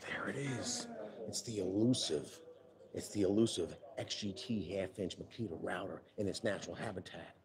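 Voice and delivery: nature documentary. Pretend. Hushed nature documentary narration.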